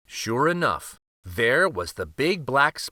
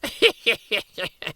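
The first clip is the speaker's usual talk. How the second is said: high laugh joining in; rueful laugh; 10 semitones higher than usual. A narrator's reading. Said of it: "so did Uncle Archibald".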